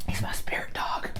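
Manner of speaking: hushed aside; whisper. Real, not acted. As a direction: whisper it under your breath. Whispered aside about the dog.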